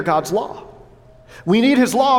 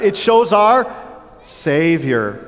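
a bit louder, rising on our; louder call; 5 semitones lower, much slower than usual. Shown as a demonstration. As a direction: push it out so the congregation answers back. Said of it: "it could be louder than that".

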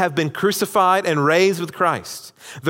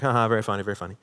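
low, quiet fake "haha"; imitated sarcastic laugh-off; 4 semitones lower, 4 dB quieter, much faster than usual. [name] to the laughing room, mock-amused and sour. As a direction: toss it off unamused, trailing away softer.

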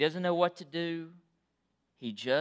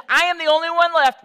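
much higher and louder, crying out the complaint; frustrated cry; 12 semitones higher, 13 dB louder than usual. Acted. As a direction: let the frustration burst out. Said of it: "He says".

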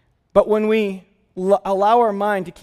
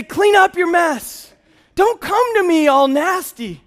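high, slow, loud scolding; mock-shout the rejection at the child; disgusted dad scolding his toddler; harsh rejection; 8 semitones higher, 3 dB louder, slower than usual. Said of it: "I didn't say".

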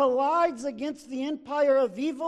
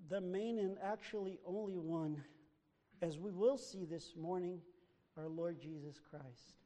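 much softer and lower, almost confiding; lowered reverent voice; hushed; 7 semitones lower, 9 dB quieter than usual. Acted. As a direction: drop to a near hush for reverence. Preacher drops to hushed aside.

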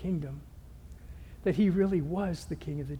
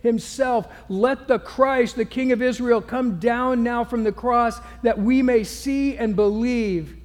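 loud, slow scornful jeering; mocking taunt; 4 semitones higher, 6 dB louder than usual. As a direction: sneer at him from below the cross. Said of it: "mocked him to one another".

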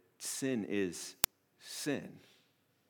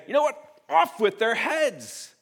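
higher, louder and slow, like a tyrant king; mock royal decree; 13 semitones higher, 9 dB louder than usual. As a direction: proclaim it from the throne with mock fury. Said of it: "to put the crown on ... and to say".

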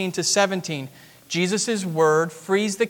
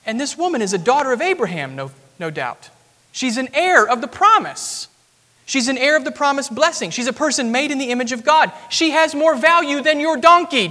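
rising, louder preaching climax; preaching at full force; 7 semitones higher, 5 dB louder than usual. Acted. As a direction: build to a ringing proclamation.

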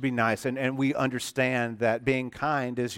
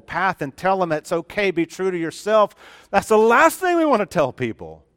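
loud, insistent pulpit preaching, hammering each line; preacher thundering a warning; full-force preaching; 2 semitones higher, 6 dB louder than usual. Acted. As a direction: drive every repeated line home to the congregation.

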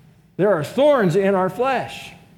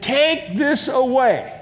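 drawn out, higher, pleading to God; slow pleading prayer; 6 semitones higher, much slower than usual. Retold or imitated. Believer pleading with God in prayer, slow and begging. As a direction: beg God slowly to take it away. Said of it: "pleaded with God and said".

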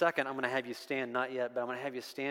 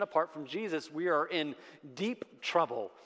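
ordinary talk, nothing marked

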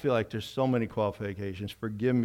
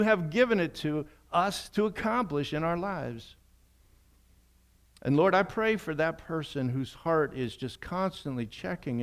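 raised, intense prayer; fervent prayer; 5 semitones higher than usual. Acted. Pastor praying fervently for those bound by opinions. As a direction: pray with rising fervour.

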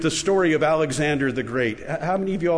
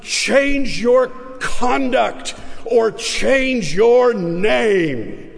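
higher, louder, slow and menacing; steely command; 8 semitones higher, 4 dB louder, much slower than usual. A narrator's reading. Furious king grabs the boy and commands, high and slow. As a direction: lay down the ultimatum with eyes of steel. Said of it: "With eyes of steel".

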